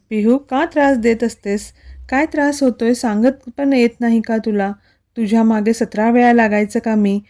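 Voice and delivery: usual speaking voice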